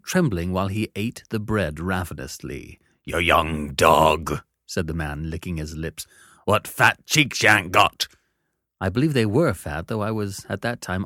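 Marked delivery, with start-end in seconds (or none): 3.0-4.4 slow, low convict growl; menacing convict. Acted. Convict licks his lips. 6.4-8.1 gruff convict. Acted. Convict leers.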